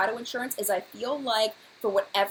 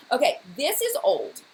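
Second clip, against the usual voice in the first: ordinary talk, nothing marked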